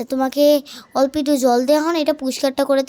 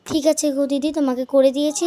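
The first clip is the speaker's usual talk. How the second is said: usual speaking voice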